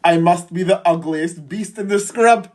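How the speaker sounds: usual speaking voice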